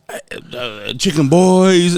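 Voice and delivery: stretched-out, singsong catchphrase; mock slogan call, slow and lingering; imitating the uncle. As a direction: draw the words out like an old commercial slogan. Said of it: "he comes like".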